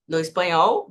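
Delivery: ordinary talk, nothing marked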